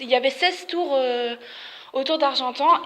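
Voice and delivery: ordinary delivery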